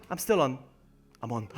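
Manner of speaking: ordinary delivery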